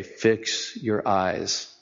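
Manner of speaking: very slow and soft; soft, drawn-out reminder; gentle urging. Acted. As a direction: lean in and slow right down. Closing exhortation to congregation.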